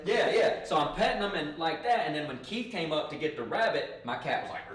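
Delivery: normal talking voice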